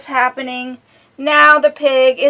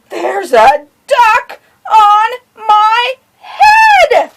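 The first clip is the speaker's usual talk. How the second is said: hammed-up cry, higher and louder; mock wail; 11 semitones higher, 9 dB louder, much slower than usual. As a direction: cry out in pretend despair.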